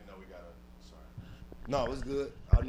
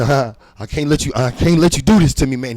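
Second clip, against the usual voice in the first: loud, raised, desperate; loud confrontation; 3 semitones higher, 9 dB louder than usual. Retold or imitated. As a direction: shout it, backed into a corner.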